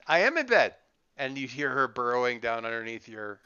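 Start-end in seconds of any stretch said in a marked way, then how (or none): 0.1-0.8 put-on high, loud little girl insisting; excited kid voice. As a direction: squeal it like a stubborn kid. Little sister playing in bed.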